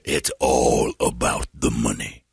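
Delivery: very low, slow put-on voice; pimp impression. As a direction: draw it out deep as the mock pimp. Voicing the pimp.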